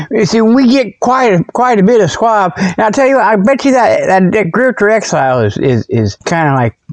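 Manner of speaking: normal talking voice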